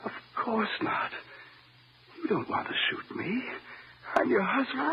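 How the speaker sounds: slow, hushed, breathless plea; frightened whisper. Acted. Husband soothes his armed wife in a frightened whisper. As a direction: whisper it like the gun is on you.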